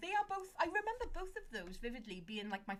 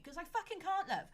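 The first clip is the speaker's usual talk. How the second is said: snappy comeback, louder and higher; retort to an ex; 6 semitones higher, 4 dB louder than usual. Retold or imitated. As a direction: snap back at the nagging ex. Snapping back at an ex.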